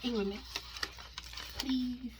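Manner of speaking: ordinary delivery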